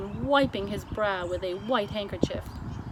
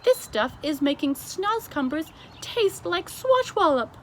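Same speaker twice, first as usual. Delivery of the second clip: BFG delighted cry; 8 semitones higher, 5 dB louder than usual. A narrator's reading. The giant tastes breakfast, crying out delighted. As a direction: relish it. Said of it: "he cried".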